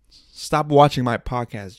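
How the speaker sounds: loud, slow warning; joking stern order; mock scolding. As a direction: lay it down loudly and slowly in pretend annoyance. Mock telling-off addressed to Jada.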